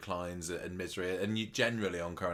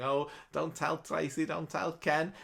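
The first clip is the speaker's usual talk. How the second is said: higher, mock tearful pleading; a touch of imitated crying, pitched up; pleading Cassie; 8 semitones higher than usual. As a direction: beg him not to tell. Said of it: "crying her little heart out".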